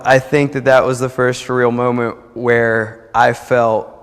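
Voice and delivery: ordinary talk, nothing marked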